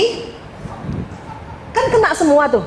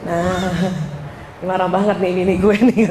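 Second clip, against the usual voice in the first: wife imitates husband noticing her anger, lower with a laugh; husband's wry remark; 7 semitones lower than usual. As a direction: quote him lightly with a laugh.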